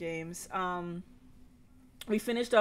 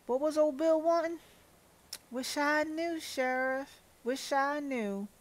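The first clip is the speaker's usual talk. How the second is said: folksy Southern character voices; Southern accent; 4 dB louder than usual. Acted. Sheriff drops in on a quarrel, folksy Southern accent. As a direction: play small-town folk with a Southern twang. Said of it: "when I put in that accent".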